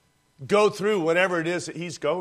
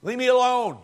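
raised, pleading outcry; anguished cry; 6 semitones higher, 4 dB louder than usual. Acted. Preacher voicing a tormented soul, crying out in anguish. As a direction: push them away in anguish.

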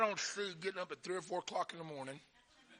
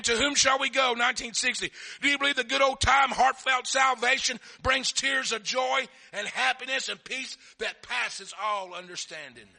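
loud, high delivery easing at the end; full-force preaching; 6 semitones higher, 7 dB louder than usual. Real, not acted.

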